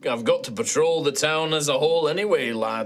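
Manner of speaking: friendly Scottish knight; easygoing Scottish dwarf; Scottish accent. Acted. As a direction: offer company in a Scottish lilt. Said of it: "Okay, it was Scottish".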